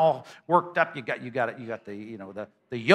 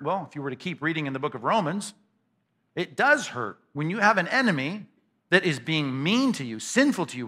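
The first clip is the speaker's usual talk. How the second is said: usual speaking voice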